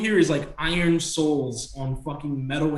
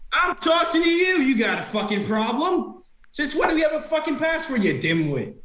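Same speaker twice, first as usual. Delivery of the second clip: very high, quarrelling redcap voice; bickering yell; 13 semitones higher than usual. Acted. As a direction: snap back high and fast in a squabble. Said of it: "begin to yell at each other".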